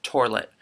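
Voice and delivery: "toilet" said with an R in place of the I, like torlet; dad's "toilet". Retold or imitated. Imitating dad's odd pronunciation, "toilet" as torlet. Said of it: "my dad actually pronounces it".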